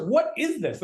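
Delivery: high, astonished retold reaction; wowed by the first bite; amazed.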